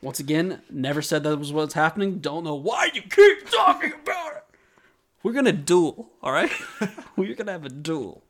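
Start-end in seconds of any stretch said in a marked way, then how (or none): 2.2-4.4 high, loud playful yell; much higher hammed-up outburst; mock shout. Mock argument with cohost. 5.2-8.2 put-on playful threat; laughing mock menace; mock challenge.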